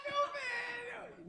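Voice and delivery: high, slow, crying call of a relieved mother; mother's relieved cry. Retold or imitated. Mother rushing into the police station for her son, high crying call.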